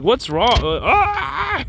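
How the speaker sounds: high, mock-exasperated wail; hammed-up frustration; exasperated outburst. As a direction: let the question climb into a strangled cry.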